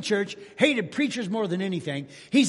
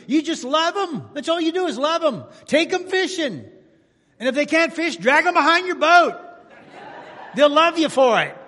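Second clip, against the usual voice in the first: higher and louder, climbing to a ringing cry; preaching at full force; 7 semitones higher, 6 dB louder than usual. Acted. Pastor urging the congregation to love their enemies, full preaching force. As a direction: preach it out, lifting every line higher.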